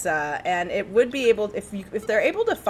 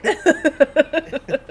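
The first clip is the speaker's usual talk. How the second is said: laughing; 8 semitones higher, 6 dB louder than usual. Real, not acted. Laughing at the pirate default.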